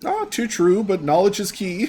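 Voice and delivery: high-pitched, fidgety clerk voice; high voice, on the edge of an awkward laugh; fidgety secretary. Acted. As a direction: play a nervous secretary brushing off concern. Secretary character, nervously chipper.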